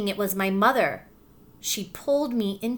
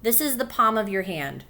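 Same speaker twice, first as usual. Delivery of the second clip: ordinary delivery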